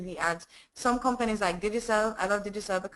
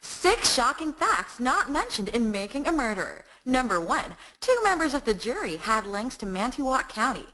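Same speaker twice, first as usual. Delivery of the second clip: higher, punchy American documentary teaser voice; American accent; 3 semitones higher than usual. Acted. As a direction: hook the listener like an American true-crime promo. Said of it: "no, the American accent".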